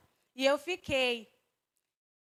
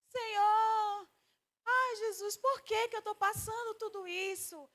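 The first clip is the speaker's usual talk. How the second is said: higher, pleading cry to the Lord, fading softer; imitating tearful prayer; 9 semitones higher, 4 dB quieter, slower than usual. Preacher recalls her crying nights to the congregation, higher tearful plea. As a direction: replay the old desperate prayer in a higher voice. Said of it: "e eu ficava lá chorando".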